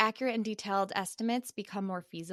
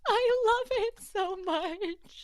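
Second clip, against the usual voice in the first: delighted squeal; 14 semitones higher, 5 dB louder, much slower than usual. Real, not acted. Delighted by cute speech.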